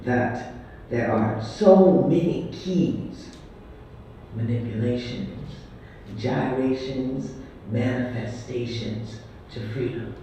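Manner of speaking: loud, deliberate poetry performance; measured, declaiming poem recital; spoken-word poem. Acted. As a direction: proclaim each line like a stage poet.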